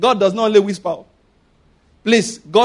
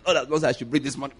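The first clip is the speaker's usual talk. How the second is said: mock reply; 7 semitones lower, 7 dB quieter, faster than usual. Mock spouse answer.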